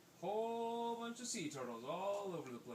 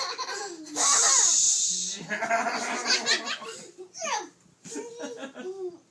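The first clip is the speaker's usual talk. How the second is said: long, loud high laughter; real uncontrolled laugh; 15 dB louder than usual. Real, not acted. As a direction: burst into prolonged giggles. Everyone breaks up laughing.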